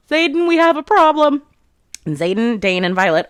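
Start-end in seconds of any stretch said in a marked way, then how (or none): 0.1-1.4 high, loud call-out; imitated yell. Garrick calls to Xaden from the staircase, imitated yell. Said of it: "he yells".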